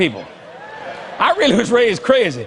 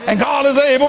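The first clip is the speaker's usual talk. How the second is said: rising church cadence on able; whooping preacher; 3 semitones higher than usual. Shown as a demonstration. Preacher whooping to the congregation, rising cadence. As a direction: lean into the whoop. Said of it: "It's called hooping".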